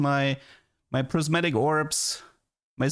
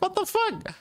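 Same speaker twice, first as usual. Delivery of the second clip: squeaky high, stunned "what the fuck"; hammed-up shocked squeal; shocked disbelief. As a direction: shoot way up in pitch like you can't believe it. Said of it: "I'm like".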